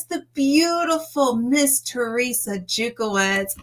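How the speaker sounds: ordinary talk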